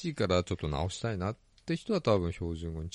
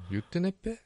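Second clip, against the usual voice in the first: Ibaraki dialect imitation; 11 semitones higher than usual. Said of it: "みたいな".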